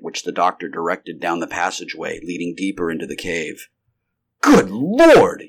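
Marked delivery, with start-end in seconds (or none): 4.4-5.4 loud, high startled outburst; sudden exclamation. A narrator's reading. Carnes suddenly sees the hideous creature, startled exclamation. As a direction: blurt it out in shock. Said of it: "ejaculated Carnes suddenly".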